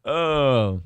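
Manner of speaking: ordinary talk, nothing marked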